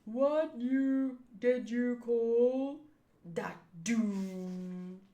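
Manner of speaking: very drawn-out dopey speech; slow-witted character voice. Acted. Slow batter questions his partner, drawn-out character voice. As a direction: stretch every word like a slow thinker. Voicing slow-talking Mister Four.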